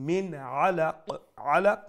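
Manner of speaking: "Min Alaq" with a clear, unhummed n; izhar with clarity. Shown as a demonstration. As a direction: sound the n of "Min" cleanly before the throat sound of "Alaq". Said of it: "with clarity".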